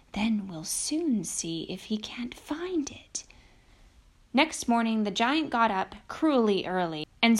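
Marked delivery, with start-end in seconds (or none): 0.1-3.2 whispered aside under the bed; to himself. Acted. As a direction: whisper it so the giant can't hear. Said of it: "said Boots to himself".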